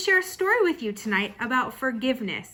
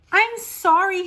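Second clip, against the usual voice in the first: put-on high, loud, upset child's plea; crying out an apology; 8 semitones higher, 7 dB louder, slower than usual. A narrator's reading. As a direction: cry out your apology like a distressed child. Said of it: "Harriet cried".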